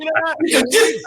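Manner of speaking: hammed-up angry yell; mock furious yell. Friend Javier yelling while punching a dude, mock angry high yell. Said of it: "That's what he told me".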